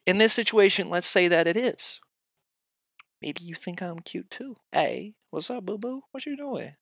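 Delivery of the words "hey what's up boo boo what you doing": high, soft cutesy come-on; mock-sweet flirting in a raised voice; flirty inner voice. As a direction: coo it high and quiet to a crush. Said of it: "what I'm saying in my head".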